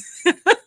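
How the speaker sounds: high, loud burst of laughter; amused laugh. Real, not acted. Host laughing at her own slip, high cackling laugh.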